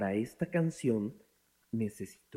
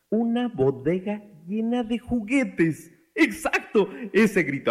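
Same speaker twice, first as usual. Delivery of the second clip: hyped-up excitement; 7 semitones higher, 4 dB louder than usual. Pretend. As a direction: dangle a dream prize before children. Hyping children up to scream. (emoción creciente, voz aguda)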